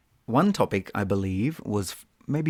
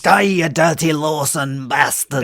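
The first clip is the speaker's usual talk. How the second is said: loud, higher mock warrior yell; battle cry; 5 semitones higher, 7 dB louder than usual. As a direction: roar it across the battlefield at the enemy clan. Playing clan warrior on battlefield.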